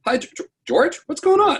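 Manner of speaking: high-pitched, stammered mock greeting; surprised friend. Susan opening the door to George with cop present, high surprised imitation.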